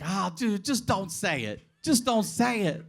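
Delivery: high, anxious begging; nervous pleading. Retold or imitated. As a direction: plead with him in a jittery voice. Nervous kid begging friend.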